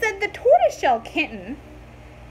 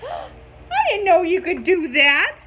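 startled kitten; 3 semitones higher, 6 dB louder than usual. A narrator's reading.

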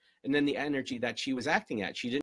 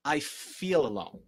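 slow, honest admission; slow sincere acknowledgement; 4 semitones higher, 3 dB louder, slower than usual. Shown as a demonstration. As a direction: say it slowly and own it. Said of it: "the truth is".

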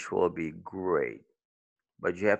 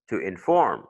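"inform" stressed on FORM; light IN, strong falling FORM in "inform"; second-syllable stress; 4 semitones lower than usual. Shown as a demonstration. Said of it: "it's on the second if it's a verb".

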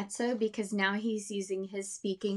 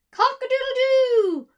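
very high, loud hammed-up crow with a laugh; rooster crow; 13 semitones higher, 13 dB louder, slower than usual. Teacher doing vocal exploration as the rooster. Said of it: "great vocal exploration".

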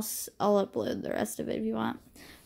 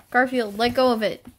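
sharp call, a bit louder; calling to the cat; 2 semitones higher, 6 dB louder than usual. Real, not acted. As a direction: tell Garfield off firmly. Telling the cat to let go.

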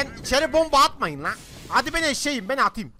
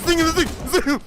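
gunfire imitation; 5 semitones higher, 4 dB louder, slower than usual. Real, not acted.

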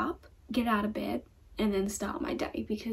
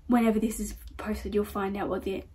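usual speaking voice

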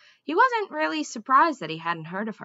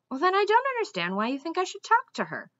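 confused; 9 semitones higher, faster than usual. A narrator's reading.

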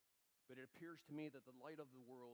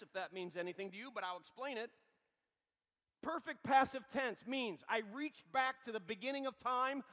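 loud, high proclamation; fiery preaching; 9 semitones higher, 14 dB louder than usual. Acted. Pastor proclaiming the finished work to the church, loud and high. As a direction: hammer the good news home.

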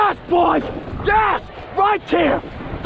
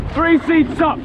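ordinary talk, nothing marked

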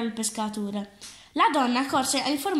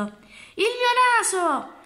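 long, drawn-out wail, much higher and slow; anguished cry; 10 semitones higher, 4 dB louder, much slower than usual. Acted. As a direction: cry out in horror at the mirror. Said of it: "si guardò allo specchio e gridò". (grido lungo e disperato, molto acuto e lento)

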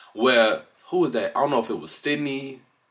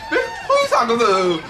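high, laughing mock confrontation; hammed-up sassy challenge; 11 semitones higher than usual. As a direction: squeal the challenge through laughter. Acting out the fighter's shout.

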